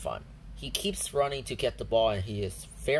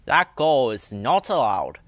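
louder objection; protest; 2 semitones higher, 9 dB louder than usual. A narrator's reading. Pedro objects to Rebecca's goal, louder. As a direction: object firmly.

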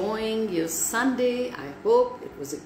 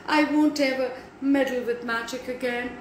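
higher-pitched pleading promise; higher, lightly acted cry; 5 semitones higher, faster than usual. A narrator's reading. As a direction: cry out the promise like a storybook apprentice. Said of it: "he cried".

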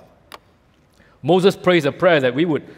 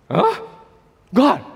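Moses baffled; 9 semitones higher, 4 dB louder than usual. Acted. Moses bewildered.

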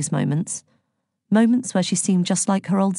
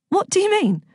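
high-pitched, trembling alarm; anxious. A narrator's reading. Mum startled by her son's news, anxious. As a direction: ask anxiously, pitch leaping up. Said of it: "she said anxiously, her voice trembling".

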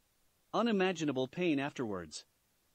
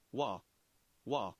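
shocked stammer; 4 semitones lower, 3 dB quieter than usual. Acted. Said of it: "could only utter in shock".

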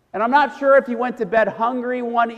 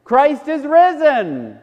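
loud, slow, raised proclamation; proclaiming; 5 semitones higher, 5 dB louder, slower than usual. Real, not acted. Preacher cueing the congregation's Easter response, ringing proclamation. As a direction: call it out for the whole room to answer.

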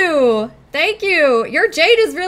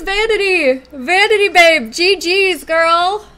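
normal talking voice

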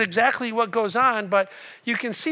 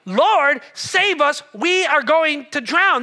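loud, higher cry for help; frightened fishermen shouting to Jesus; panicked cry; 4 semitones higher, 5 dB louder, slower than usual. Retold or imitated. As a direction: plead over the storm. Disciples cry out in storm.

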